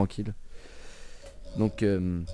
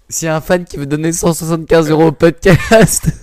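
mock plea; 6 semitones higher, 11 dB louder than usual. Joking plea for money.

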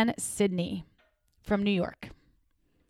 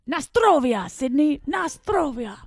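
attempted Polish accent; 5 semitones higher than usual. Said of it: "wanted it in a Polish accent".

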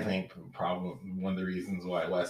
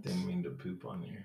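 soft unvoiced aside; whispering; 11 dB quieter, faster than usual. Real, not acted. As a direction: whisper the apology. Apologetic aside.

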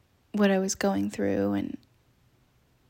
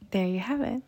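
nervous laugh; 4 semitones higher, 3 dB louder than usual. Real, not acted. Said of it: "Can you tell this is a nervous laugh?".

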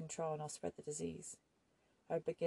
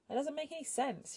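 higher, louder angry outburst; 10 semitones higher, 6 dB louder than usual. A narrator's reading. Woman fights his reason for staying, angry high outburst. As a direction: snap it at him, voice raised and high. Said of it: "she said angrily".

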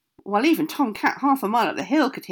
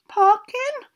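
timid squeak; 7 dB louder than usual. Acted. Billy answers timidly.

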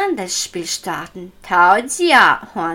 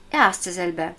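ordinary delivery

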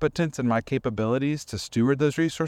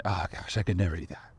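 softer, deflated, giving up before trying; resigned sigh; 6 semitones lower, 6 dB quieter, faster than usual. Retold or imitated. Comparing yourself to a piano player, softly resigned. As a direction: sink into quiet self-doubt. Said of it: "resignation statement like that".

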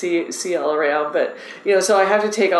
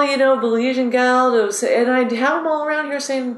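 ordinary delivery

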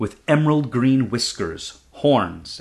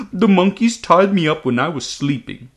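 higher, louder dragon voice, aggrieved; upset dragon; 6 semitones higher, 5 dB louder than usual. Acted. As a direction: complain like a wronged young dragon. Dragon voices his complaint.

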